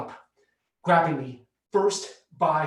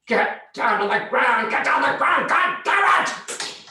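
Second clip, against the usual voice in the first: very high, loud, slow yelled command; slow shouted order; 9 semitones higher, 6 dB louder, slower than usual. Retold or imitated. Cops closing in and yelling at the teens, very high, loud and slow. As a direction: drag out each word, screaming.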